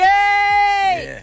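loud, high shout of yeah. Real, not acted. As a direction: belt out a hype yell. Hype call back to Ricky.

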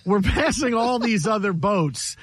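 ordinary talk, nothing marked

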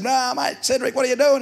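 a hint of a high, loud pub mate; lightly voiced boisterous mate; matey greeting.

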